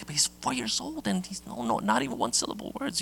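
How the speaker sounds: high, soft, worried voice of his wife; imitating the wife in a mild panic; a hint of a fretting mother over a late talker; wife freaking out. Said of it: "my wife freaks out".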